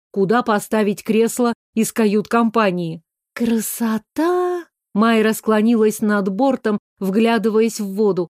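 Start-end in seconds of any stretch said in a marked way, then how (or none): 3.3-4.7 slow, drawn-out admiring exclamation; delighted. A narrator's reading. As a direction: savor the word with wonder. Girl admiring sea view.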